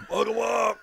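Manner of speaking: very high imitation of a crowd yell; drunken heckler yell. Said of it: "very drunkenly, had to yell".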